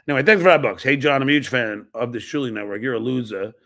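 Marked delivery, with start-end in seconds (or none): none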